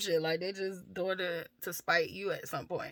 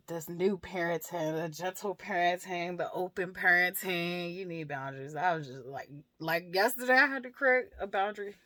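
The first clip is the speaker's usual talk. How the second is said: ordinary delivery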